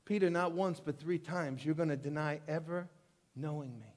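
soft, low, sorrowful reply; sad Jesus. Retold or imitated. Preacher voicing Jesus answering Peter, quiet and sorrowful. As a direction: let it sink down sadly to almost nothing.

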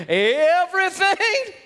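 mock disbelief, dragged out and climbing; incredulous mocking. Dad throwing son's complaint back at him, incredulous. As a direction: stretch it out into a rising question.

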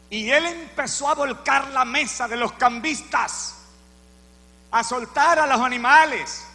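usual speaking voice

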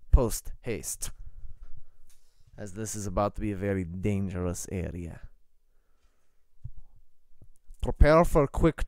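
put-on commanding prelate in a Russian accent; brief the party formally, Russian-accented. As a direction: Eastern European official giving orders. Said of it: "at the Russian accent".

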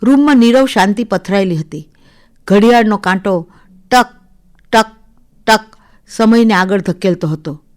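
plain reading voice

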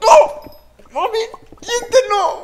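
very high, loud screams then laugh; excited screaming. Real, not acted. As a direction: scream wildly, then taunt.

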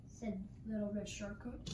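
ordinary delivery

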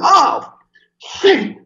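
very high mock gasp of dread; hammed-up band panic; retold alarm. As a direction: imitate the guys' frightened yelp. Bandmates hear scary story.

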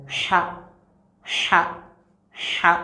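"Cha" said with a smooth, soft breathed h, no throat scrape; smooth soft letter sound. Shown as a demonstration. Teacher drilling students on the smooth soft "Cha". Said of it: "il faut répéter plusieurs fois".

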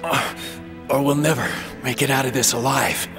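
low, halting injured voice; weak, wounded plea. Acted. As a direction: plead through pain, voice sunk low. Wounded man pleading for help.

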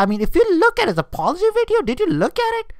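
very high, fast, incredulous question. Real, not acted. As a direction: shoot up high in mocking disbelief. Mocking jockey's apology video.

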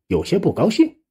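usual speaking voice

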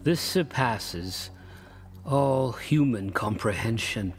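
very slow, dazed delivery; bewildered and slow. Acted. Lost old traveler to his passenger, slow and dazed. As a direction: let the words drag out in confusion.

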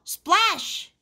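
high, exclaimed splash; spring up high on the word; splash effect. A narrator's reading. Sound effect in story.

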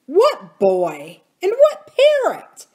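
loud questions leaping up in pitch; demanding. A narrator's reading. Uncle Jocelyn staring at his wife as if mad, demanding. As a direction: demand answers, exasperated. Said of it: "he demanded".

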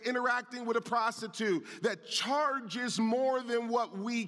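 rolling sermon cadence, pressing each point; preaching at full force. Acted. Pastor warning the congregation about sin, preaching at full force. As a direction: drive the message home to the church.

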